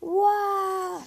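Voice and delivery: long, high wailing wah; sustained high-pitched wail; howl. A narrator's reading. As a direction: howl like a child bawling. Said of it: "she howled".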